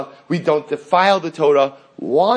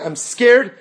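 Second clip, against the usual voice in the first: Moshe's frightened confession, a little higher and louder; a touch of fear; 6 semitones higher, 4 dB louder than usual. Acted. Moshe tells God about the angels, a touch of fear. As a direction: say it like you're scared, lightly.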